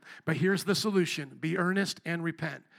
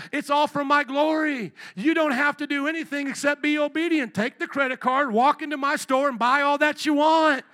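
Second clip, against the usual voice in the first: louder, higher preacher's cry; rising preaching; 7 semitones higher, 6 dB louder than usual. Acted. Preacher voicing the father offering everything. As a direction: build it like a preacher.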